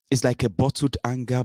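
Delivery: ordinary talk, nothing marked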